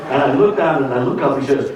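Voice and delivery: ordinary delivery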